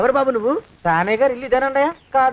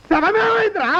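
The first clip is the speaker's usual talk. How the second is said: high-pitched, agitated question; panicked demand; 7 semitones higher than usual. Acted. Master asking about the vanished corpse, panicked demand. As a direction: cry out in acted alarm.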